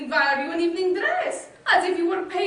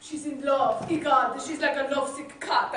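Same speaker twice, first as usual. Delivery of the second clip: ordinary talk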